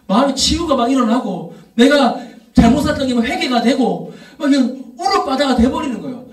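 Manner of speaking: usual speaking voice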